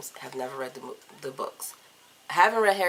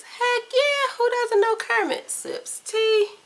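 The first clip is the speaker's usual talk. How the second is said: high, loud Kermit the Frog impression; 7 dB louder, much slower than usual. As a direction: squeak it out like the frog himself, playful. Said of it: "Do you know who Kermit the Frog is?".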